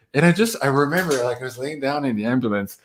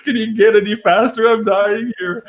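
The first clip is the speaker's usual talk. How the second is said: desperate plea; 10 semitones higher, 3 dB louder than usual. Retold or imitated. Retold pleading with slow nurse.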